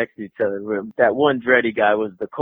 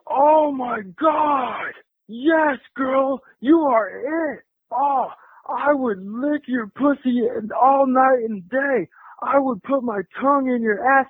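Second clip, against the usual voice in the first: put-on high, slow drunken catcall; plastered drunk; 10 semitones higher, slower than usual. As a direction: imitate a drunk hippie drawing out his words. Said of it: "plastered drunk".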